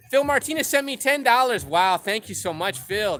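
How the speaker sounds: real excitement over a surprise donation; thrilled. Real, not acted. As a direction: announce the gift like it made your day. Reads out a viewer donation.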